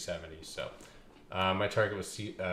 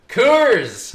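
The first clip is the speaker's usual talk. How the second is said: loud call; 13 semitones higher, 17 dB louder than usual. Real, not acted. Calls out to viewer by name.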